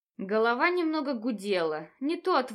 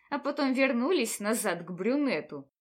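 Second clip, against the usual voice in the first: ordinary delivery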